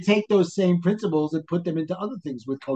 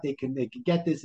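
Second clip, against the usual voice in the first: ordinary talk, nothing marked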